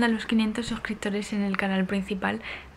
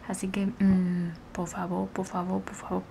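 soft pleading; 3 semitones lower, 7 dB quieter than usual. Real, not acted. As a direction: beg quietly, trailing away. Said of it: "pleading softly".

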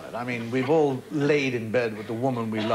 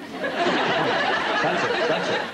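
breathy, laughing hostess line; wheezing out the hostess's all-done line; 3 semitones higher, much slower than usual. Retold or imitated. Mocking the hostess to the audience, laughing. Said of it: "and then she goes".

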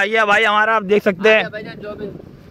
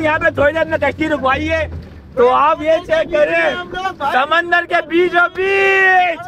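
shouting over wind; 8 semitones higher than usual. Real, not acted.

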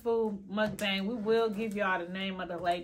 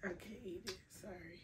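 quiet; 15 dB quieter than usual. Real, not acted. Rejecting the food, quiet.

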